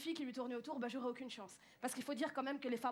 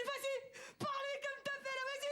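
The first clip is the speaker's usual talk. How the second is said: excited boss; 9 semitones higher than usual. Retold or imitated. Boss voiced eagerly.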